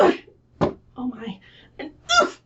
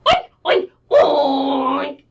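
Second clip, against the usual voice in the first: squealing oink; 3 semitones higher, 3 dB louder, much slower than usual. Acted. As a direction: squeal like a piglet. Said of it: "And he said".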